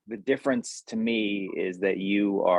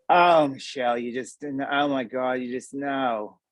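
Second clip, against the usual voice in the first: lightly voiced Jersey mother; a hint of a disappointed Jersey mom; 3 semitones higher than usual. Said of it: "my Jersey accent combined with".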